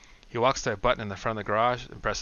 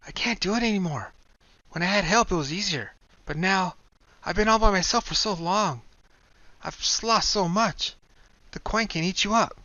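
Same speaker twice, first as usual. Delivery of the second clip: tearful Kai; 11 semitones higher, 5 dB louder than usual. Acted. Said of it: "Kai starts to tear up".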